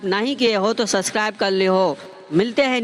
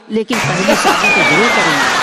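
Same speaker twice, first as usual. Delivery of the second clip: louder, blurted with relish; loud punchline; 3 semitones lower, 6 dB louder than usual. Acted. Husband teasing his wife about remarrying, loud and gleeful. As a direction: burst out the cheeky twist.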